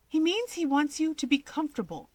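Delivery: high, quick reassuring voice; hurriedly smoothing things over; eager explaining. Acted. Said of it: "Fuyumi translates".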